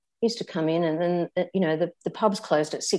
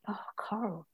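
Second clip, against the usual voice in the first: a soft, breathy whispered hello; hushed greeting; 3 semitones higher, 12 dB quieter than usual. Retold or imitated. Father whispering her name at the door, hushed. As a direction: whisper it like an old man surprised at the door.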